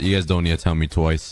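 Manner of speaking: normal talking voice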